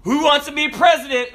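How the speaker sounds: high, loud, eager question; teacher calling out. Acted. Teacher polling kids on career day, loud and high. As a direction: throw it out to the whole classroom.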